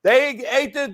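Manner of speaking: usual speaking voice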